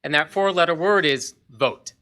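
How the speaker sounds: ordinary delivery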